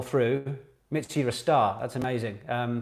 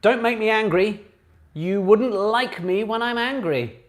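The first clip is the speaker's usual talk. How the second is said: menacing quote; 7 semitones higher, 4 dB louder than usual. Shown as a demonstration. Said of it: "reads film quote dramatically".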